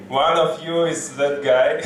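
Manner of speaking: ordinary talk, nothing marked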